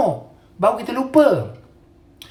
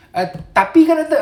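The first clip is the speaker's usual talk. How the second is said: mock objection, higher pitched; bantahan pesakit; 8 semitones higher, 4 dB louder than usual. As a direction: imitate a patient pleading "but, doctor". Mimics patients who keep objecting. (mengajuk pesakit membantah, nada tinggi)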